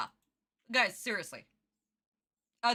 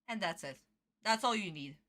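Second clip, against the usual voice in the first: usual speaking voice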